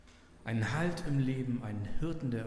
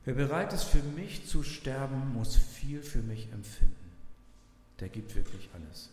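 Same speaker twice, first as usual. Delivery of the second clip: solemn quotation reading; 3 semitones higher, 4 dB louder than usual. Acted. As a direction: lean into each sentence as a read quotation. Pastor reads devotional quote.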